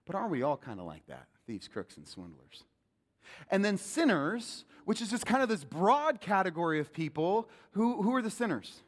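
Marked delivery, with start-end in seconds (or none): 0.6-2.6 "Thieves, crooks, and swindlers" said low and soft, almost a whisper; hushed. Shown as a demonstration. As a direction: let the list sink to a confession. Same list turned inward, hushed.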